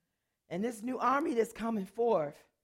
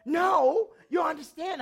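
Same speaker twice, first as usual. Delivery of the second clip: higher, louder, pressing the point; insistent shout; 9 semitones higher, 10 dB louder, slower than usual. Retold or imitated. Roommate insisting she is serious, loud urgent shout. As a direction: push hard so she finally hears. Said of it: "she was like".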